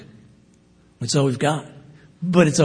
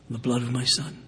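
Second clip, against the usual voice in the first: in the blood of my son, repeated softer; 7 dB quieter, faster than usual. Acted. Preacher echoing God's promise softly to the congregation. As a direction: drop the repeat to a soft, sincere close.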